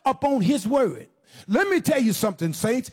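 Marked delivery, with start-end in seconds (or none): none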